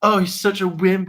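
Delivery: a hint of a scornful Blake; mocking Blake. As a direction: sneer it like a smug rival.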